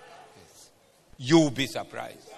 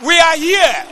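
much higher, loud rallying cry; loud call out; 13 dB louder than usual. Real, not acted. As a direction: call it out to the whole church. Calling out to congregation.

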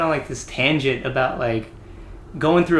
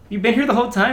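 higher, louder incredulous chief; mock chief disbelief; 10 semitones higher, 5 dB louder, much faster than usual. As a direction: ask in disbelief. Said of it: "he's like".

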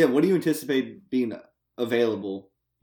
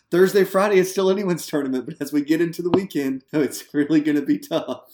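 higher, louder golf commentator voice; golf announcer; 5 semitones higher, 5 dB louder than usual. Retold or imitated. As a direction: call it like a golf tournament broadcast. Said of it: "a golf announcer talking".

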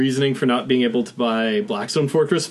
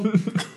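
suppressed giggle through the nose; stifled giggles; 8 semitones higher, 5 dB quieter than usual. Real, not acted. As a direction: giggle while trying to hold it in. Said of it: "Trying not to giggle".

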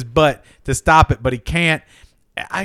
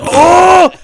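high, loud sustained "ohh"; involuntary cartoon howl; sound-effect outburst; 12 semitones higher, 9 dB louder than usual. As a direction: let out a sudden comic wail. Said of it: "How do you make that sound effect?".

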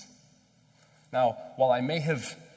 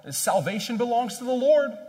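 loud, high-pitched proclamation; Jonah crying out; 12 semitones higher, 8 dB louder than usual. Acted. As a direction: cry it out to the heavens. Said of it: "And he cries out".

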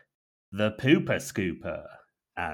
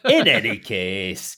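slow, louder Yorkshire; North Yorkshire accent; 11 dB louder, slower than usual. Acted. Jizz mopper resuming, North Yorkshire accent. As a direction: drag it out in broad Yorkshire. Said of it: "in his gruff North Yorkshire tone".

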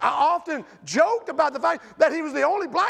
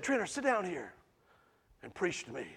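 soft quoted mentor; 8 semitones lower, 12 dB quieter than usual. Retold or imitated. Old pastor quoted.

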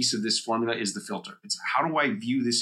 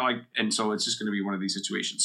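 ordinary talk, nothing marked

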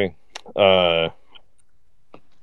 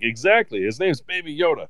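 pitched-up, insistent agreement; emphatic high voice; 8 semitones higher than usual. Retold or imitated. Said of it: "I'm like".

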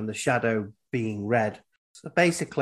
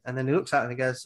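usual speaking voice

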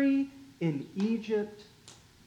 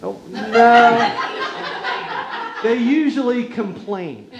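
laughing; 4 semitones higher, 4 dB louder than usual. Real, not acted. Chuckling at complaining people.

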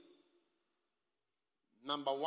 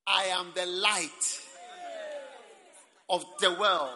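slow and louder, each word weighed; slow loud proclamation; 2 semitones higher, 6 dB louder, slower than usual. Acted. Preacher quoting Jesus to the congregation, slow loud proclamation. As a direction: proclaim it to the whole hall.

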